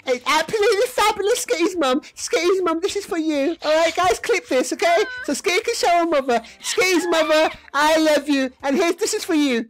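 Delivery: high-pitched, fabulous camp gushing; exaggerated high-voiced adoration; gushing streamer routine. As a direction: squeal it in a put-on high voice. Camp shout-out to friend's mum.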